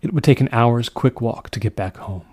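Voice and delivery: ordinary delivery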